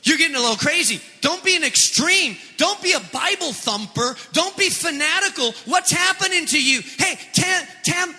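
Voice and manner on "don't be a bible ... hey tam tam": high, shouted mock scolding; hammed-up critic yelling to tame it down. Critics yelling at the believer to tame it, mock shout. Mocking critics telling you to quiet.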